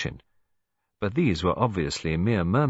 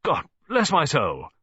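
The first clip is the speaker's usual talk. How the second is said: slow, high, louder outburst; startled exclamation; 7 semitones higher, 4 dB louder than usual. A narrator's reading. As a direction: blurt it out in shock. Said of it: "he ejaculated".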